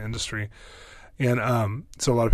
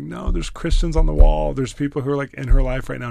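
retold grieved protest; 5 semitones higher, 10 dB louder than usual. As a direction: protest as if pained on her behalf. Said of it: "I was so grieved".

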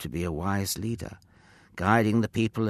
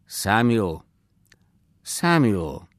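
louder, drawn-out call of a name; mildly raised summoning voice; 6 dB louder, slower than usual. A narrator's reading. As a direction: call the boy once more. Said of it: "the voice called once more".

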